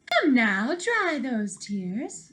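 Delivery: slow, soothing comfort; gentle. Acted. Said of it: "said a gentle voice".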